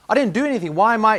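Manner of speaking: high, pleading protest from a schoolboy; boy to strict teacher, whining in protest. Retold or imitated.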